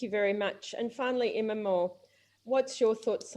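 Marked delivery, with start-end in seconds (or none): none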